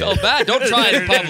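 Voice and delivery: high, laughing warning; giggly teasing. Real, not acted. Friend laughing, warning not to do the face in public. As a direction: tease through laughter.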